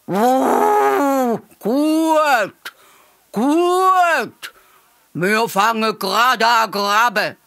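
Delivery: high, loud, drawn-out elephant trumpet; hooting elephant cheer, long swooping vowels. A narrator's reading. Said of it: "der Elefant trompetet".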